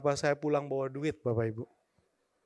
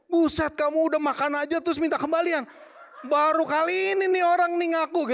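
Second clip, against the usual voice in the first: much higher, louder, laughing disbelief; the soto seller flares up, half laughing; angry soto seller; 13 semitones higher, 5 dB louder than usual. Retold or imitated. Soto seller scolds the boy who asks for change, high and loud. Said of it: "Wah, dia marah".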